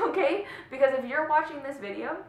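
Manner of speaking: high, loud laugh spilling into the words. Real, not acted. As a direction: laugh it off, then push on.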